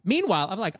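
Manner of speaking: ordinary delivery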